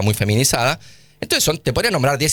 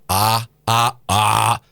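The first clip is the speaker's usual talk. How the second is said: a lower, steady "A, A"; sound A pitched low; 3 semitones lower, much slower than usual. Shown as a demonstration. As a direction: drop down and say "A" again as a demo. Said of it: "Yo digo A".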